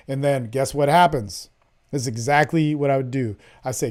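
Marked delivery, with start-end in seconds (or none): none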